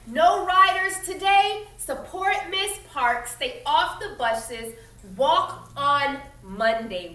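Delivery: loud, slow, sign-reading voice; poster slogans. Acted. Reading the boycott posters aloud to the class, loud and slow like a rally chant. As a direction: proclaim each slogan like a rally cry. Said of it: "The posters read".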